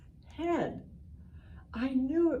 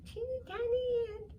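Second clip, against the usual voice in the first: put-on much higher little voice for a teeny ant; tiny squeaky voice. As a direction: squeak it out like something very small.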